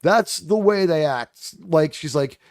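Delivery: ordinary talk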